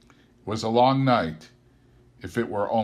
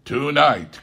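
loud high alarmed cry; 7 semitones higher, 9 dB louder than usual. Acted. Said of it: "cried Scrooge".

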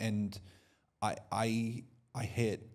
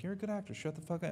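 playful higher echo of Shut the fuck up; mocking echo of costar; 7 semitones higher, 3 dB quieter, much faster than usual. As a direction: echo it back higher, teasing. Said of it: "I'm like".

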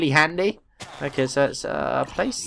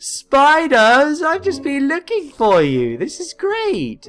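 high gasping delight; thrilled to find them; gleeful greeting to spiders; excited; 10 semitones higher, 3 dB louder, slower than usual. Real, not acted. Finds spiders he wanted.

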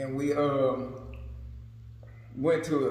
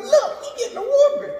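put-on much higher kid voice; little kids mocking the boy getting whooped; gleeful little kid; 3 dB louder, faster than usual. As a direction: tease like a giggling child. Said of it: "little kids over there talking".

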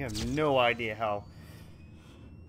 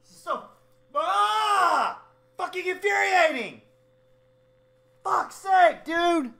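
high, strained furious yells; enraged shouting; 11 dB louder than usual. Real, not acted. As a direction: rant at the screen at full pitch. Gamer raging at game.

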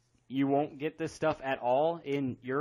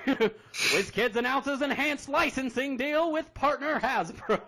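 put-on slow, higher old-time radio announcer; 1930s radio voice; 8 semitones higher, 5 dB louder, slower than usual. Shown as a demonstration. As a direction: read it like a 1930s newsreel. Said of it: "in my nineteen thirties radio voice".

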